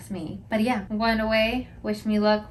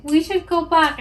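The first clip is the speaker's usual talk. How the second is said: panicked; 7 semitones higher, 7 dB louder than usual. Retold or imitated. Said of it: "and I freaked out".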